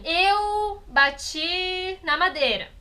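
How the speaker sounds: "eu bati na madeira" said very slowly, each word separate, "eu" stressed; slow word order demo. Shown as a demonstration. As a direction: lay out the sentence slowly, word by word. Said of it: "se eu falar assim".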